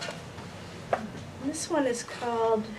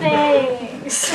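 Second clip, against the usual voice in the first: laughing; 2 semitones higher, 12 dB louder than usual. Real, not acted. Poet laughs at host's joke.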